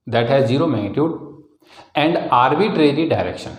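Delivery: slow, measured classroom dictation. Real, not acted. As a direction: read it out slowly for note-takers. Teacher dictates a definition for writing. (धीरे-धीरे परिभाषा लिखवाते हुए)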